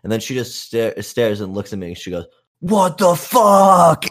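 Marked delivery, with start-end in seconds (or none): 2.6-4.0 high, loud, drawn-out mock scream; retold outraged scream. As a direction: imitate a sassy girl screaming.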